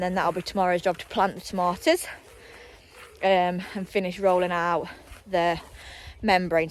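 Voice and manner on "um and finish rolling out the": usual speaking voice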